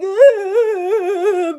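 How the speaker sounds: long, high, loud groan; imitated helpless moan while being jostled; wailing groan. Imitates herself hanging in rocked car.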